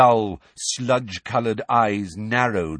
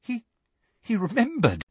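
high, halting astonishment; faint with fright; 11 semitones higher than usual. A narrator's reading. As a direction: stammer it out, about to faint. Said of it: "as though she was about to faint".

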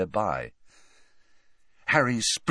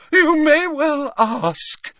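slow, very high, loud indignation; shrill, drawn-out theatrical retort; 8 dB louder, much slower than usual. A narrator's reading. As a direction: screech it with wounded dignity. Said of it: "she said shrilly".

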